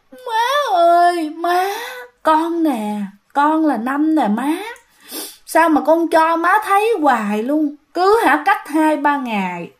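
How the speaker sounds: put-on high tearful plea of a hungry ghost child; crying plea. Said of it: "khóc mà nói vậy đó".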